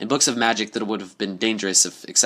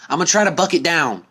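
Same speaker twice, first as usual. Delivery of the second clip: higher, louder, resolute; determined call; 8 semitones higher, 4 dB louder than usual. A narrator's reading. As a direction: gear up to kick the door. Applejack shouts determination.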